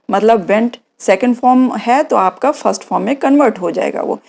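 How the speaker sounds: ordinary delivery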